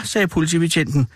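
ordinary delivery

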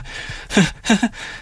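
high helpless giggling; high laugh as the nerves give way; hysterical laugh. A narrator's reading. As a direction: laugh compulsively, out of control. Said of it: "Jeg må bare hele tiden le".

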